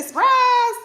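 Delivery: very high, loud shriek; spirit's surprise scream. Acted. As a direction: scream it as a gleeful ambush. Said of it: "spirit say".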